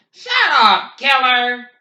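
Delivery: usual speaking voice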